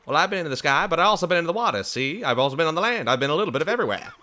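high, bouncy 1920s showman voice; Conan O'Brien old-timey voice. Acted. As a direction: play it like an old-timey radio host. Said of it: "my best Conan O'Brien does up twenties voice".

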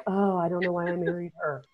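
soft, put-upon imitation of a husband; martyr husband. Dad on TV about his wife, mock martyred. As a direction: lament it like a long-suffering martyr. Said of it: "Dad plays the martyr".

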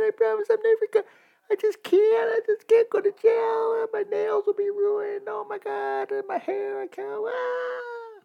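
high falsetto whine, hammed-up sobbing pleas; mock crying woman. Host mocking hit-and-run drivers crying in court, high hammed-up falsetto whine. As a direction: mimic a weepy defendant begging in a squeaky high voice. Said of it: "they start crying and going".

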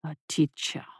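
deep, soft, mock-humble monster; low beast feigning modesty; false modesty. A narrator's reading. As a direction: play humble while looming. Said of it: "with false modesty".

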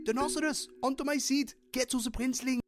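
put-on high, light young unicorn; a bright heroine unicorn's voice. Unicorn character voice.

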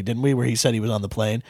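ordinary talk

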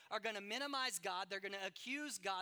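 loud, driving sermon cadence; pounding pulpit delivery; full-force preaching. Acted. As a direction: preach it hard to the congregation.

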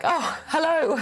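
high giggly greeting to a newborn; bright laughing oh hello. Retold or imitated. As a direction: laugh it out, giddy relief.

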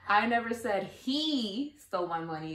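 he singled out, slow read; slowly, leaning on he; stress on HE. Shown as a demonstration. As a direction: point at he. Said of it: "the words that you stress".

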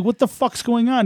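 high, drawn-out demand; high-pitched retold disbelief; exasperated alarm. Retells his alarmed phone reaction.

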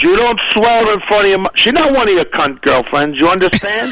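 lightly voiced dad telling off his teenage son; mock angry dad. Retelling his father's dinner-table rebuke, mild mock anger. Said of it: "My father got mad".

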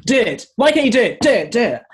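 high, loud barking demand, imitating a tough choreographer; mock-yell the orders like a ruthless dance coach; Abby Lee Miller. Choreographer pushing a struggling dancer, loud high mock bark. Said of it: "like Abby Lee Miller in Dance Moms".

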